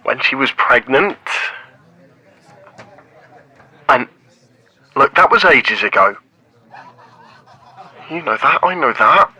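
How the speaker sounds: loud, blurting out each broken piece; loud confession. Acted. Man admits he left her at the altar, loud and defensive. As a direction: shout it out, defensive and guilty.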